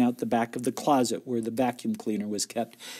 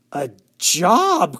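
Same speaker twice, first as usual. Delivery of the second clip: raised, loud, incredulous question; astonished cry; 6 semitones higher, 8 dB louder than usual. A narrator's reading. Sisters hear mother has a job, astonished cry. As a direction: cry out in surprise at the news. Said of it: "cried the sisters".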